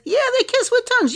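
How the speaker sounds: high squeaky repeat; high-pitched mock echo. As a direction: sing it out high and fast, teasing. Repeating line in mocking voice.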